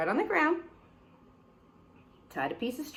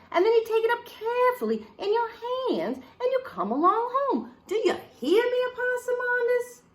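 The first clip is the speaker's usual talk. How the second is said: high, loud exasperated mother; scolding mama; 6 semitones higher, 6 dB louder than usual. Acted. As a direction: lay into the child like a fed-up mama. Mama lectures child.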